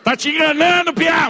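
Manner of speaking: high, rising exasperated yell; outraged shout. Acted. Father wanting to debunk mother's healing kiss, outraged yell. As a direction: build into a screaming protest. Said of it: "eu quero gritar pra Deus e o mundo".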